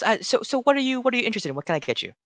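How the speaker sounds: high, eager little shopkeeper voice; halfling shopkeeper. Acted. Halfling shopkeeper asking the customer what they want, high and eager. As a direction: pitch it up like a keen halfling salesman.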